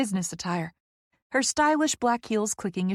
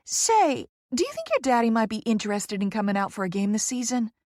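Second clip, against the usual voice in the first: Poppy, effusive Southerner; 2 semitones higher than usual. Acted. Poppy angling for the celebrity father, eager Southern voice. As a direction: wheedle brightly in a Southern voice.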